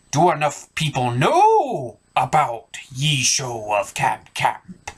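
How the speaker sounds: slow, drawn-out pirate voice; an old sea dog asking slyly. Acted. Pirate asks host.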